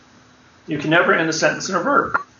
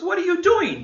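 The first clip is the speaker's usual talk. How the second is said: sudden, much higher startled question; astonished judge; 14 semitones higher than usual. Retold or imitated. Judge sees the defendant doing a handstand, astonished and high. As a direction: blurt it out in shock.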